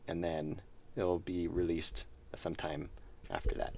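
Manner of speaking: soft whispered aside; murmure-le comme un secret; whispering. Real, not acted. Whispered aside about release.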